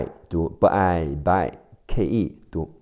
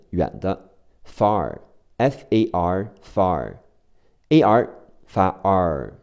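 usual speaking voice

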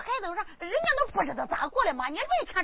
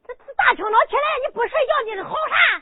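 scolding shout; 6 semitones higher, 5 dB louder than usual. Acted. Wife scolding early morning noise.

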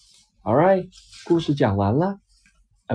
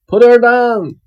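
loud, higher call; cry out; 9 semitones higher, 11 dB louder than usual. Acted. Sap the spider ordering the wolf, loud call. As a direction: shout to stop the wolf. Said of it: "came a cry".

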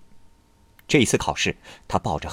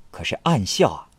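usual speaking voice

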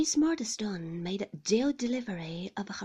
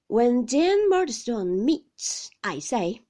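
loud, slow resumption; insistent reproof; 3 semitones higher, 11 dB louder, slower than usual. A narrator's reading. Husband resuming after his wife's plea, loud and deliberate. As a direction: press on weightily, stretching the words.

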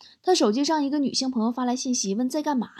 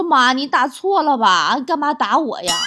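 loud, indignant complaint; aggrieved protest; 2 semitones higher, 6 dB louder, slower than usual. Acted. Grown child to mum after the rolling-pin blow, loud and aggrieved. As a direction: protest loudly at being hit.

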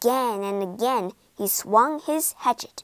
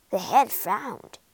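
ordinary delivery, nothing marked

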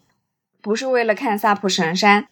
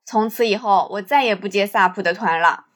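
ordinary talk

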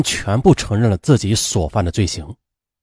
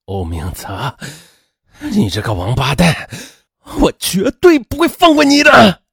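cursing in fury; 4 semitones higher than usual. A narrator's reading. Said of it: "他大骂道". (咬牙大骂，越骂越激动)

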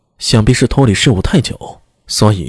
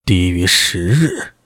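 put-on stern old minister; 4 semitones lower, slower than usual. Changsun questions doubtfully.

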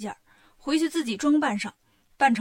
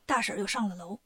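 ordinary delivery

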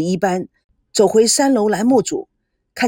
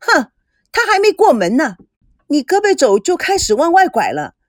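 higher and louder, biting; loud retort; 4 semitones higher, 3 dB louder than usual. Acted. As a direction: fire it back at him. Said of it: "赵美娇也大声的喝着".

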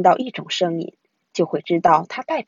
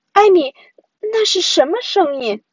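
put-on high, startled child's question; 9 semitones higher, 5 dB louder than usual. A narrator's reading. Brother alerting sister to a strange sound, startled. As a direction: call out loudly, suddenly alarmed. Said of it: "忽然他露出惊讶的表情".

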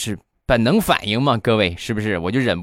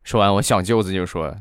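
ordinary talk, nothing marked

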